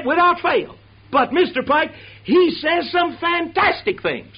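high, slow, awestruck praise; gushing fan. Retold or imitated. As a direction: marvel at the preacher's words. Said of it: "people always say to me".

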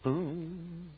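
high, soft, held "hmm"; imitated wary hum. Shop folk reacting to a stranger's accent, mock hushed hum. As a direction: mimic a hushed, drawn-out hum. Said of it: "gets real quiet and goes".

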